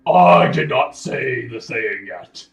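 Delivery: loud, slow booming rebuke. Acted. Magical voice correcting the adventurer who spoke too soon, booming. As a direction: thunder it out, drawing out the words. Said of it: "you hear a booming voice".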